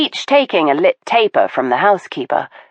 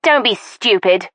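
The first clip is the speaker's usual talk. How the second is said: a sharp, irritated retort, raised a little; snapped; 5 semitones higher than usual. Acted. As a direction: cut him off curtly. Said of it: "Mertensia snapped".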